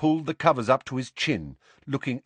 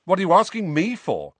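loud, higher-pitched indignant retort; sharp retort; 8 semitones higher, 5 dB louder than usual. A narrator's reading. Harry retorts to Seamus's question, indignant and loud. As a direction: throw the question back, voice raised. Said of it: "Harry retorted".

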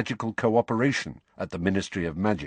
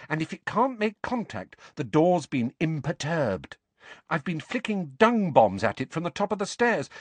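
higher-pitched, lively character voice; higher, animated storytelling dialogue; animated young Ginny; 8 semitones higher than usual. Acted. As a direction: voice an eager girl explaining her experiment.

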